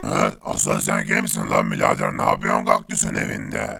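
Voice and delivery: a tough robot with no tune in the voice; gruff toneless robot. Acted. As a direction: speak like a machine, threatening.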